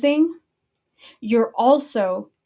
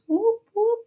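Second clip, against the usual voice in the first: a much higher mock siren whoop; 12 semitones higher than usual. Vlogger mimicking sirens at red flags.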